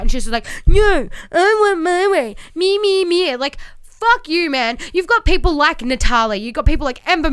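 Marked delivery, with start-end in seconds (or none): 0.7-3.4 put-on high, slow whining, like a spoilt child; whiny brat mockery. Mocking Sasha to the co-host as a spoilt brat, whiny slow voice. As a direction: drag it out like a brat stamping her foot.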